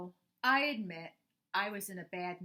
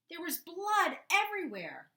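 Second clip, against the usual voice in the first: higher, louder alarmed telling; dramatic exclamation; 11 semitones higher, 8 dB louder than usual. A narrator's reading. Narrator telling the scraped-knee horror, alarmed. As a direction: sell the gore with alarm.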